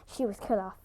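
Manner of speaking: usual speaking voice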